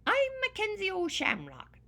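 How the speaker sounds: angry leprechaun shouting his name. Acted. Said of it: "I shouted".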